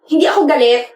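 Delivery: mildly heated, defensive denial. Acted. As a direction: deny it with a touch of irritation. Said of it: "ba't ka sumisigaw".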